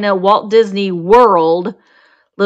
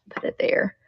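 whisper; 11 dB quieter than usual. Real, not acted. Quiet aside while packing item.

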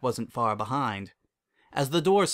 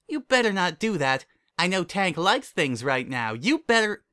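high, louder mock warning; scolding dog voice; 7 semitones higher, 3 dB louder than usual. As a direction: wag a finger like an eager dog.

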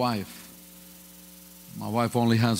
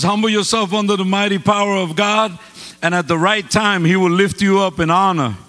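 louder, higher preaching cadence; 6 semitones higher, 6 dB louder than usual. Acted. To the congregation, preaching at full force. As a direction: drive the scripture home to the congregation.

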